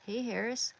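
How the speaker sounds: normal talking voice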